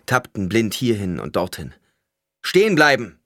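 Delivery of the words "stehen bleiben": high, loud shouted order; calling out. A narrator's reading. Paul halts the blind men in the street, shouting. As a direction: shout to halt people. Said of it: "rief Paul".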